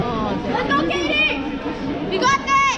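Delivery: high cheering shout for a teammate. Real, not acted. Cheering Katie on from the stands, a high shout. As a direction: yell her on from the stands.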